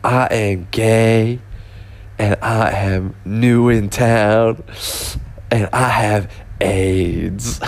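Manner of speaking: slow, drawn-out stand-up character voice; homeless man. Retold or imitated. Homeless man introducing himself to comedian, slow mock announcement. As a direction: draw each word out in a mock announcement. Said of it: "the homeless person introducing himself to him".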